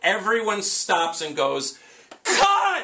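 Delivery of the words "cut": high, loud yell to halt the scene; alarmed shout. Retold or imitated. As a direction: re-enact a panicked crew stopping everything. Everyone halts the scene in alarm.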